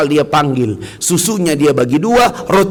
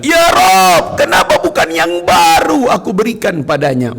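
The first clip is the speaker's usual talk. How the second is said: cry out; 4 semitones higher than usual. Retold or imitated. Pious man crying out to God over the coat. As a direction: call out to God in regret. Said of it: "Dia teriak".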